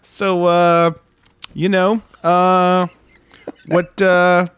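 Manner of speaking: nosy friend easing hesitantly into an awkward question; Mike. Retold or imitated. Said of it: "Mike's like".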